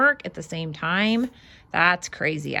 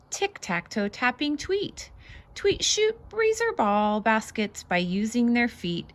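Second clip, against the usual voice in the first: lively, bouncy read-aloud voice; singsong picture book reading; reading to children; 3 semitones higher than usual. Acted. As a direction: read it out like a storybook for little ones. Reads picture book aloud to kids.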